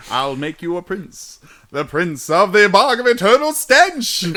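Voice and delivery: mock-British villain, building to a loud high cry; hammed-up British proclamation, swelling; British accent. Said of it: "say enigmatic things in a British accent".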